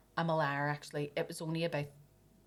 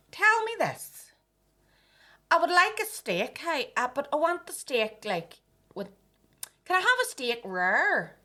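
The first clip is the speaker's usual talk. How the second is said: higher, louder Ballymena accent mimicking a customer; 8 semitones higher, 5 dB louder than usual. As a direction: do the rude customer's Ballymena accent, loud and high. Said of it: "she had, like, a Ballymena accent".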